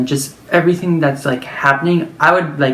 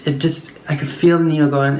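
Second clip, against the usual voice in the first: usual speaking voice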